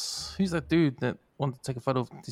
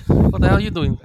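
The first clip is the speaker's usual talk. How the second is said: louder, startled retold outburst; mock outraged yell; 6 semitones higher, 10 dB louder than usual. Retelling a stranger hugging Winston Peters mid-interview, mock outraged yell. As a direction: bark it out like a startled politician.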